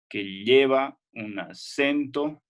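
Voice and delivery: "que lleva un acento" said very slowly, leaning on "lleva"; slow stress demo. Shown as a demonstration. Teacher slowly repeats the phrase for the class, stressing "lleva". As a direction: draw "lleva" out so the accent is obvious. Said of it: "el acento aquí iría en lleva".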